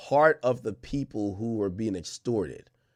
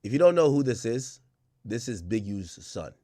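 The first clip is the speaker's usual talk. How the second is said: normal talking voice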